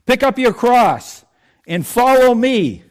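the preacher giving Jesus's call to follow. Retold or imitated. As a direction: voice Jesus summoning, drawn out and lifted. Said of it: "he's saying".

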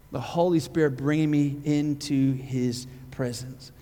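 ordinary talk

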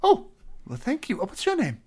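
high, stammering nervous greeting; timid satyr voice. Acted. To the tavern crowd, a high, stammering shy hello. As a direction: squeak out a shy hello at the bar.

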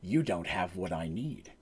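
low, hushed villain voice; villain's taunt. Retold or imitated. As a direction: drop low and soft, coolly dismissing a beaten foe. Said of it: "he just laughs and he goes".

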